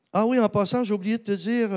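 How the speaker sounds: put-on French accent. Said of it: "excusez l'accent français". (imitation légère de l'accent français, plus aigu)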